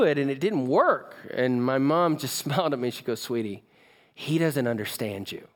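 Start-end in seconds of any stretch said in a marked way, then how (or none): 3.2-5.5 soft, low and tender; gentle explanation. Retold or imitated. Mom explaining dad's condition to her son, softly. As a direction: break it gently in a lowered voice.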